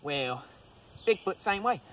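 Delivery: ordinary delivery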